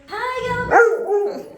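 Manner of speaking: very high, loud squeal of hello; excited pet greeting. Real, not acted. Greeting her old dog as he wakes, excitedly.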